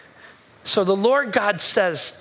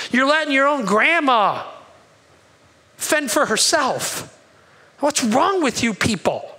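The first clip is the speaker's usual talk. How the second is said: raised, higher voice scolding his people; scolding rebuke; 5 semitones higher than usual. Acted. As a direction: rebuke them like a disappointed prophet. Preacher voicing the prophet's rebuke.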